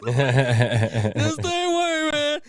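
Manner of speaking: real laugh, loud drawn-out words. Real, not acted. Host cracking up with the co-hosts, laughing. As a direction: say it through helpless laughter, stretched out.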